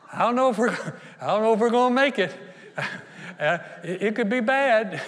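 high, loud worried whine with nervous laughs; hammed-up fretting doubter; mock nervous doubt. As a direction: stammer it out, pretending to panic. Acts out fearful doubter with nervous laughs.

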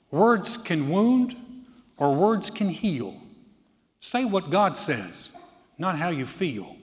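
poem recitation. Acted. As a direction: recite it slowly, line by line. Recites own poem to congregation.

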